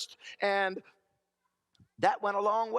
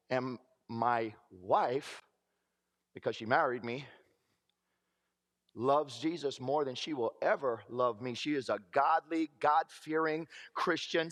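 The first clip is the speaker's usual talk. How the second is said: tender; 6 semitones lower than usual. Real, not acted. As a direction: let it land gently. Tender words about his wife.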